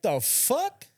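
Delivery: high, disbelieving "the fuck?"; sudden outraged disbelief; a startled squawk of disbelief; shocked. Retold or imitated. Shocked at seeing his car.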